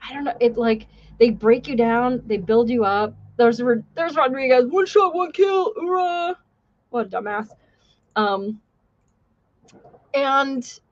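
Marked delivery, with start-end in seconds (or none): 4.7-6.4 gung-ho shout. Retold or imitated. Classmate's gung-ho motto.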